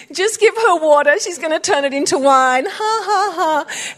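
high, jeering mock taunt with a fake laugh; mocking coworkers. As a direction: sneer it like office bullies. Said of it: "they kept mocking her".

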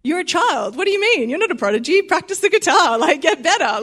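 high, incredulous telling-off; mock scolding. Preacher answering a kid who won't practice guitar, mock exasperated scolding.

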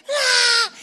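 high piercing mock scream, topping the last one; imitated child scream. Preacher imitating grandson outscreaming his sister. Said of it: "Havelock thought, I can beat that".

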